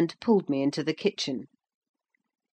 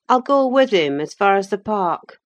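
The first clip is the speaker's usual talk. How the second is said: higher, louder offer; young farm man; 5 semitones higher, 7 dB louder than usual. Acted. Young man offering to guide the visitor, forthright.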